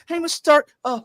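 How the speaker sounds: mock an over-keen boy hero in a squeaky pitch; exaggerated high, hyperactive pleading; high-pitched, hyperactive kid. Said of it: "high-pitched and, you know, hyperactive".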